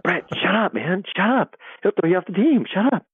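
high-pitched, imitated teammate warning; urgent pleading. Teammates plead with player to stop.

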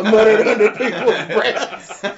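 ordinary talk, nothing marked

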